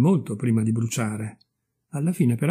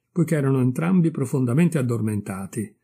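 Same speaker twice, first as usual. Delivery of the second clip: ordinary delivery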